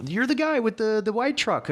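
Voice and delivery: high excited fan voice; imitating a fan. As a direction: squeal it like an eager fan. Fans recognizing him, mimicked.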